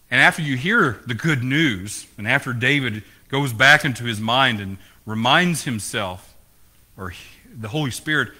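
usual speaking voice

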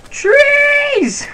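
high thrilled cry of joy; excited shout. Real, not acted. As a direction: yell it out delighted. Finally finds trees.

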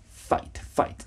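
whisper-soft model of "fight"; soft clear F. Shown as a demonstration. Teacher quietly modelling the F in "fight". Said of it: "the pronunciation should be F".

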